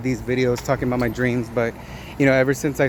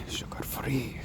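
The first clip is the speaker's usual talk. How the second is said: breathy whisper of sugar-free; 14 dB quieter, slower than usual. Real, not acted. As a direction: drop to a whisper.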